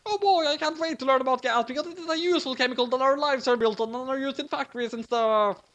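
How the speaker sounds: a mild nerdy shriek; high monotone nerd; boring shriek. A narrator's reading. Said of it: "Nier shrieked really boringly".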